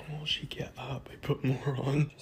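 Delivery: hushed whisper so she sleeps. Real, not acted. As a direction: whisper it close to the mic. Partner asleep, hairspray prank.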